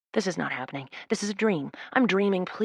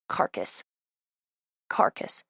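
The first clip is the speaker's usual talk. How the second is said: breathy hushed repeat of the word; carcass, breathed almost silently; whispered word; 9 semitones lower, 5 dB quieter, slower than usual. A narrator's reading. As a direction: let the word fall to a whisper. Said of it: "I stare at the word".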